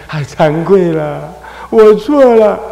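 drawn-out, rising mock wail; demonstrated tearful confession; remorseful crying. Shown as a demonstration. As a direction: cry out your shame, slowly. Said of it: "这样哭是可以的".

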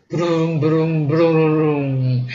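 sputtering engine noise made by mouth; imitated motor rumble. A narrator's reading. Storyteller imitating the generator starting, rumbling.

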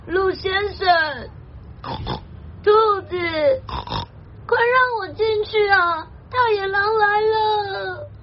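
high, wailing piggy plea; squeaking pig. Acted. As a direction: plead high like a frightened pig. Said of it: "Squeaked the pig".

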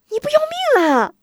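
put-on high, loud, fast alarmed girl; shocked. Sister hears the plan, shocked high cry. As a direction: gasp it out in alarm. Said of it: "吓得韩晶差点没跳起来".

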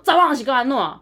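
mild mock yell at the doorman; retold angry shout. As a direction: confront him hard. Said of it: "冲到他面前".

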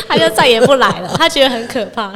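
laughing. Real, not acted. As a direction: laugh out the ending. Laughs at coworker story. (笑着说出结局)

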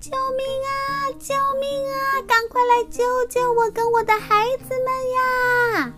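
hen crying for help. Acted. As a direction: wail for rescue from the island. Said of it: "他就很大声地说".

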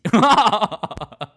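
high, loud burst of laughter. Real, not acted. Laughing at own blunder to listeners. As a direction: crack up at the misunderstanding.

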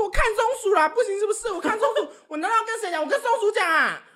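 high, loud laughing talk-back; student telling the teacher she watched squirrels, defiant and loud; defiant retort. Retold or imitated. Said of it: "我说".

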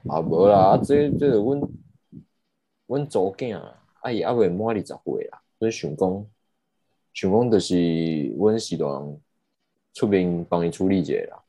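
retold reply in colloquial Taiwanese Hokkien; imitated Taiwanese speaker. Father answering the crash call for his daughter, imitated in Taiwanese. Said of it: "对方开始跟我唠台语啊".

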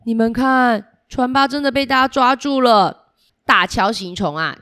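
usual speaking voice

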